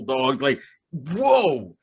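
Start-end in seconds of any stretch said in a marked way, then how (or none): 1.1-1.8 high, loud amazed cry; amazed outburst. Retold or imitated. Reader reliving a trippy comic twist, amazed. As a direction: re-enact being blown away, pitch leaping up.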